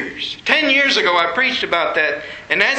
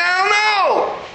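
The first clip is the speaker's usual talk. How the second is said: high, drawn-out childish mock wail; hammed-up first-grader's wail, pitched way up; bawling first grader; 11 semitones higher, slower than usual. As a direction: imitate a little kid bawling the words. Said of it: "this time like a first grader".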